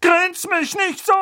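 high, loud thundering order. Acted. Aunt thundering at grinning Harry. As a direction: thunder it at him. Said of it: "donnerte Tante Magda".

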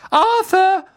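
high, loud call; Misses Weasley calling out. A narrator's reading. Misses Weasley calls her husband from the train, shrieking high. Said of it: "rief Misses Weasley".

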